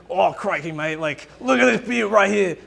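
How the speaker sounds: hammed-up Australian wildlife-host excitement; Steve Irwin. Preacher imitates TV animal handler for the crowd, bad excited Australian accent. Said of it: "do a really bad Australian accent".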